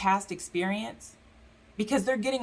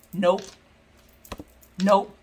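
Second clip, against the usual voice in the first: firm refusal; 6 dB louder than usual. Retold or imitated. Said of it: "Spirit's like".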